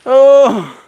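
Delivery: loud high gasp; gasping for air; after a workout. Real, not acted.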